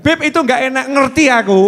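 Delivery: high, loud whiny complaint; mock wife complaining. Wife complaining to the Habib, high and loud. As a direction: whine it like the upset wife.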